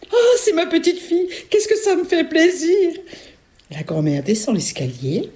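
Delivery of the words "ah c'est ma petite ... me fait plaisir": very high, gushing granny; delighted grandmother. Acted. Grandmother welcoming her granddaughter, delighted high voice. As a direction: gush with joy in a high voice.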